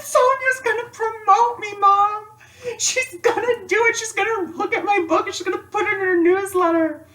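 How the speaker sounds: tearful joy. Retold or imitated. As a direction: blurt the news to Mom through happy tears. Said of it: "So I cried about it".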